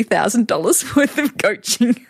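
laughing through the words; chuckling while telling. Real, not acted. Laughs at own figure.

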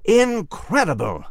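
exclaimed. Acted. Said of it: "Nigel exclaimed".

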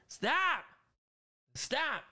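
high sharp command; scolding the dog. Real, not acted. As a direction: tell the dog off. Scolding dog during livestream.

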